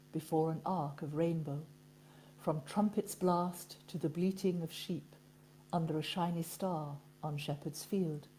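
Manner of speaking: soft, measured poetry recital; poem reading. Acted. Reading a friend's Christmas poem to listeners, softly measured. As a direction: read it gently, pausing between the phrases.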